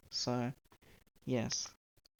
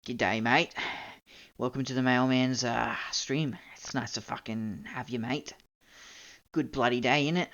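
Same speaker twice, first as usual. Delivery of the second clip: exaggerated Aussie accent; 4 semitones lower than usual. Shown as a demonstration. Streamer greets viewers to show off a broad Australian accent. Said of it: "my accent's not very strong, I can't really".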